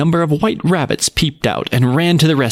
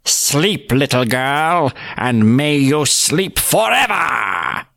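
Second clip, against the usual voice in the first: sinister witch lullaby; much slower than usual. Acted. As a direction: lull her with hidden menace. Witch grinned.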